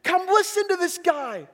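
high, loud, jubilant cry with drawn-out words; calling the people over. Retold or imitated. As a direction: shout the good news to the whole town. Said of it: "she's going back to the people".

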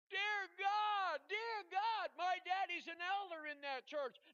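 very high, loud frantic exclamation; going nuts. Retold or imitated. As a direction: imitate a nurse beside herself, shouting. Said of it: "now she goes nuts".

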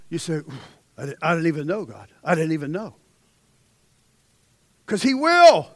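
loud, high, ringing sermon delivery; full-force preaching. Acted. Preacher reading Hezekiah's story to congregation, preaching loud. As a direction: preach it to the back row.

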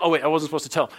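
usual speaking voice

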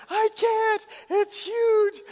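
high, loud imitation of a panicked kid; mock panic. Daughter phones dad about a spider, hammed-up panic. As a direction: wail it like a terrified child. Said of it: "And she's panicked".

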